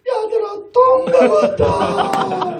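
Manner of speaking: high, loud, drawn-out cry of joy; overjoyed shout. Retold or imitated. Relieved father calling his children that the chicken came, ecstatic. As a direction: call the kids like you won the lottery.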